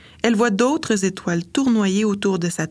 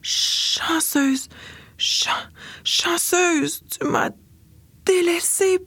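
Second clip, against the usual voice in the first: much higher, pleading and agitated; a feverish girl begging her lost friend, agitated; 10 semitones higher, slower than usual. Acted. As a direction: cry out to the one who left you. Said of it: "dit-elle d'une voix agitée".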